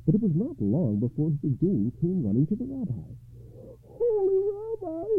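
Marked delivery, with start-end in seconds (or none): 3.8-5.0 high-pitched desperate poor man; wailing. A narrator's reading. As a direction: wail for help in a high voice. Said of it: "he wailed".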